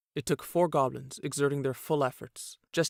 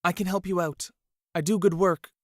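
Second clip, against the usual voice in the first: higher and louder urgent plea; desperate pleading; 4 semitones higher, 5 dB louder than usual. A narrator's reading. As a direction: sell yourself to save your life. Peter pleads for his life.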